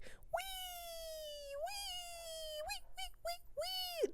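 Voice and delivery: tiny high-pitched squeal; squeaky wee. Retold or imitated. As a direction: squeal like the little pig in the ad. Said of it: "that old Geico commercial".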